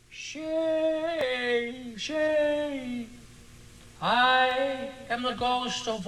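high, slow quavering ghost wail; ghostly wail. Acted. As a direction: wail it like a screech owl. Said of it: "high-pitched, piercing wail ... quavering voice of a screech owl".

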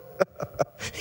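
a voiceless, hammed-up chuckle; breathy mock laugh. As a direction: laugh under your breath like an amused father. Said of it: "he begins to laugh".

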